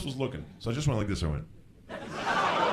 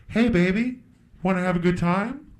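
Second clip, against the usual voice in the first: higher, put-on streetwalker come-on; hooker; 6 semitones higher than usual. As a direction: proposition a passer-by in a put-on voice. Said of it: "superhero being propositioned by a hooker".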